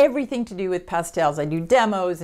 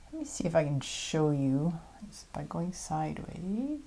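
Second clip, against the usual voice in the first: whispering; 7 dB quieter than usual. Real, not acted. Showing pastel sheen to camera.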